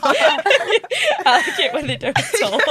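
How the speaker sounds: loud, high, laughing delivery; high-pitched and loud with laughter; laughing joke. Real, not acted. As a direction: blurt it out through laughter, stretched out. Joking, group laughing along.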